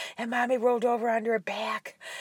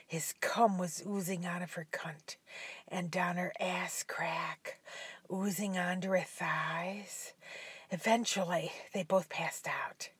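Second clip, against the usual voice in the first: whispering; 3 semitones lower, 10 dB quieter than usual. A narrator's reading. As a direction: breathe the ending quietly. Whispered closing narration.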